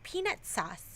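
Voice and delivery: "Peanut sauce" said soft, T dropped; saying peanut sauce. Shown as a demonstration. Wife asked to say peanut sauce, softly. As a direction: demonstrate "Peanut sauce" quietly. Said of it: "they asked me to say".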